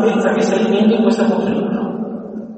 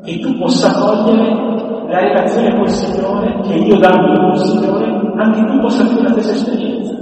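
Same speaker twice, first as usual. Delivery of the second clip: scripture reading; 3 semitones lower than usual. Acted. Preacher reads David pleading with Saul, mildly raised and solemn. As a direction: proclaim David's words with weight.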